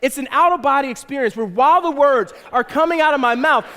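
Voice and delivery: ordinary talk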